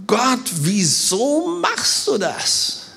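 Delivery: slow, drawn-out plea; bewildered complaint. Acted. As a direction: ask it slowly in baffled protest. Acts out puzzled reader questioning God.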